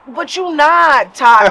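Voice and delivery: drawn-out slow "But you not"; slow deadpan put-down. Retold or imitated. Telling a fake local Todd off, slow deadpan put-down. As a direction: stretch it out, deadpan dismissal. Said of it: "you're like".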